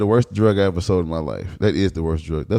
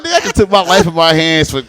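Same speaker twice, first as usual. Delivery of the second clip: louder, higher, indignant; 6 semitones higher, 5 dB louder than usual. Retold or imitated. After the robbery, loudly indignant about laundry money. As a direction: complain loud about the tiny take. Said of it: "I was like".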